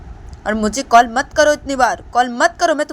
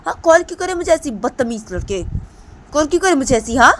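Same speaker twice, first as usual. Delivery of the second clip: put-on much higher, louder girl's shouted rant; angry shouting; 12 semitones higher, 9 dB louder than usual. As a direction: yell at him to stop calling. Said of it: "तो दीपांता बोलती".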